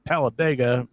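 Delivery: hammed-up Southern twang; American Southern accent. Shown as a demonstration. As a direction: slip into a comic Southern accent. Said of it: "three or four different British accents".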